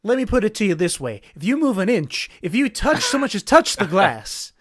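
loud, high American movie-hero threat; American superhero movie voice. Acted. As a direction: deliver a forceful warning in a put-on American accent. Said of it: "American superhero movie voice".

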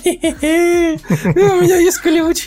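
very high squeaky character voice; silly squeaky voice. Pretend. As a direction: squeak it out while laughing. Joking continuation of voice game.

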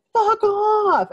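high mock yell of a heckle; retold shout of friends heckling a group. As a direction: fling it out like a rowdy friend in the dark. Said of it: "start yelling".

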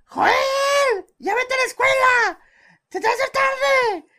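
very high, loud screeching mother call; neighbor yelling. Retold or imitated. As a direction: yell up the house at a sleeping kid. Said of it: "la vecina gritándole a Joel". (vecina gritándole a Joel para que vaya a la escuela, a todo pulmón)

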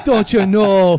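a light Minnesota "Don't you know?"; Minnesota accent. Shown as a demonstration. Confirming the accent for his cohost with a light Minnesota twang. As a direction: toss off the classic Minnesota catchphrase. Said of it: "Is that a Minnesota accent?".

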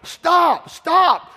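put-on sharp shouted warning to a child; parent yelling stop. Parent shouting at a child running to the street. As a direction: yell it like a parent seeing a car coming. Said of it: "you're screaming and hollering".

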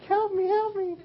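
very high falsetto cry for help; cartoon damsel in distress. Tied-up lady on the tracks cries for rescue, high falsetto plea. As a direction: squeak it out like a helpless cartoon heroine.

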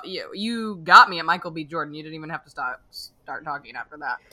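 usual speaking voice

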